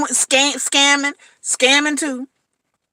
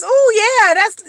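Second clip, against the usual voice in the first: swooning woman; 8 semitones higher, 4 dB louder, slower than usual. Retold or imitated. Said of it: "They just hear accent and a nice voice".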